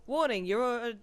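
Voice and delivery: reading a warning aloud. Real, not acted. Player reading out a point-of-no-return warning, urgent read-out. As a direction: read the game notice out urgently.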